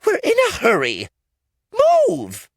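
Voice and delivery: high, loud and fast; hurrying the men out of the way; high, quick impatient order. Acted. Farm girl impatient.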